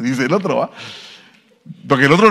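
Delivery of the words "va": ordinary talk, nothing marked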